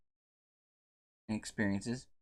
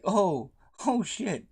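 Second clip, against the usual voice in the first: panicked; 10 semitones higher, 4 dB louder, slower than usual. Retold or imitated. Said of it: "his buddies are like".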